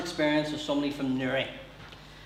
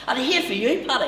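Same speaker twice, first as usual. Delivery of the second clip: put-on Newry accent; 8 semitones higher, 5 dB louder than usual. Newry man voiced.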